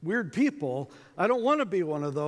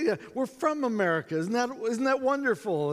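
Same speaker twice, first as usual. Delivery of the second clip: high, overly cheery agreement; mock chirpy friendliness; keeping it light; 7 semitones higher than usual. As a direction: play up the delight to win someone over. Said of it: "trying to keep it light".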